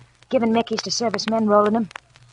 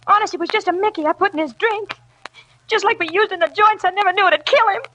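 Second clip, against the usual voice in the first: frightened confession; 8 semitones higher than usual. Acted. Lola confessing the poisoning.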